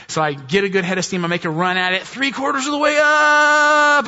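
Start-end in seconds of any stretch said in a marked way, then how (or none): 2.0-4.1 high, loud, stretched-out cry of effort; straining upward yell; excited shout. Retold or imitated. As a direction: shout it as the car nearly makes it. Retelling a run up icy hill.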